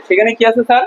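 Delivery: ordinary talk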